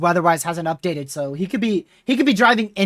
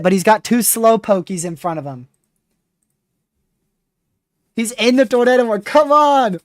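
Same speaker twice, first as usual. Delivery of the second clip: excited; 4 semitones higher, 4 dB louder than usual. Real, not acted. Chaser driving into the hook.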